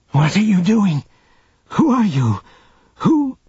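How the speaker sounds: high, startled demands; alarmed questioning. A narrator's reading. R'hllor running into the cabin, high alarmed questioning. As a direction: question sharply, pitch leaping on the last word. R'hllor bursts in, eyes wide.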